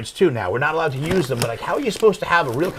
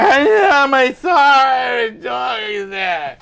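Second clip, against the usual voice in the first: upset woman; 7 semitones higher, 5 dB louder, much slower than usual. Retold or imitated. Upset driver after crash.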